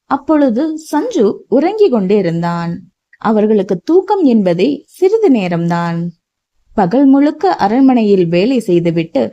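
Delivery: plain reading voice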